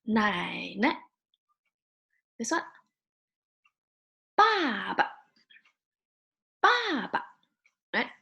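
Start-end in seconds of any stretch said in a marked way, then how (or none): 0.0-1.0 "Nai ne" with a long dipping "Nai", short "ne"; "Nai ne" with a toneless, clipped last syllable; neutral tone ending. Shown as a demonstration. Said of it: "and fast". 4.4-7.2 "Ba ba" high and falling, then a quick toneless "ba"; "Ba ba" with a short, light last syllable; neutral tone ending. Shown as a demonstration. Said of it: "you have to say it very".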